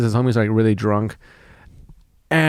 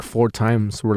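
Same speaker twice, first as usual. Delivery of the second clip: ordinary talk, nothing marked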